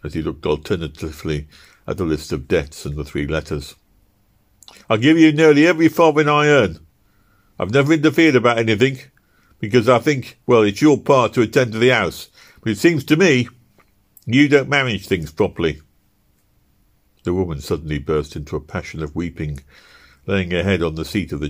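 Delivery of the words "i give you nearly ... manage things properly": raised, higher, louder voice scolding his wife; husband berating his wife about the money, high and loud; angry husband. Acted. Husband scolding wife over debts.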